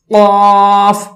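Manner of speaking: قاف said full and heavy, vowel held long; thickened. Shown as a demonstration. Teacher demonstrating a green-marked letter, thick heavy قاف. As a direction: make قاف thick and draw it out. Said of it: "موٹا بھی کر رہے ہیں".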